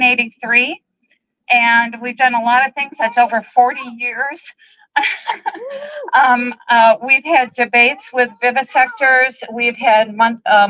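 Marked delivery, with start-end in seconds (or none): 5.0-6.1 tearful laugh. Real, not acted. Emotional speaker overcome.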